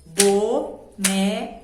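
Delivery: "Boneca" slowly as bo... ne... ca; "Boneca" in three firm, spaced beats; syllable by syllable. Shown as a demonstration. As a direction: tap out each syllable of "Boneca". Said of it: "batendo a mãozinha numa mesa".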